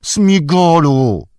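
high, loud drawn-out cry; despairing call. A narrator's reading. Frodo desperate to make Smigol obey, despairing shout. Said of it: "zawołał Frodo z rozpaczą".

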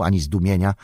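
plain reading voice

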